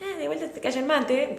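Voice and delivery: a hammed-up cartoon voice mocking yourself; voz de personaje. Shown as a demonstration. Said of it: "una voz de personaje". (voz de personaje burlona, mucho más aguda)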